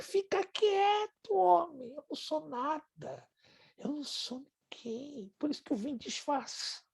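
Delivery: mock protest. Retold protest to admirer.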